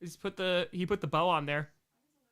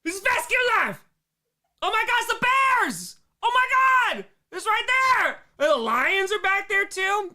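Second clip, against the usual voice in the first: excited shouting; 13 semitones higher, 6 dB louder than usual. Real, not acted. Excited reaction to home footage.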